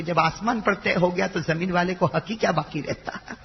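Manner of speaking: ordinary talk, nothing marked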